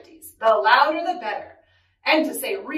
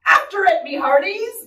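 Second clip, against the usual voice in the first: loud, raised captain's order; commanding; 6 semitones higher, 6 dB louder than usual. Acted. Captain sends crew after the lost ball, commanding. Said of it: "Braidbeard commanded".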